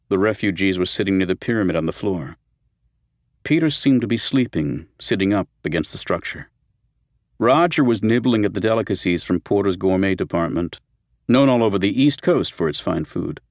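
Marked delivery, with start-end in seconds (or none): none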